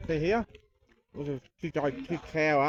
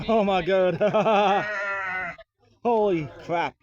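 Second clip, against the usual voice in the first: loud, amazed outburst; real delight, words bursting out loud; excited exclaiming; 3 semitones higher, 7 dB louder, slower than usual. Real, not acted. To the goats and sheep, a loud amazed cry. Overwhelmed by the crowd of animals.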